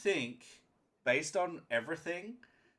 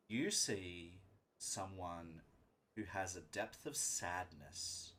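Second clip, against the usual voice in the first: game master's scene setting; 5 semitones lower, 7 dB quieter than usual. Acted. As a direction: set a somber scene, hushed and measured. Game master describes a character's inner state.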